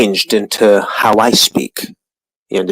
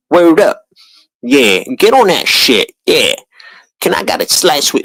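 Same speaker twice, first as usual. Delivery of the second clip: sneering impression of young Brooklyn slang; hammed-up street voice ordering a slice; mocking street slang; 7 semitones higher than usual.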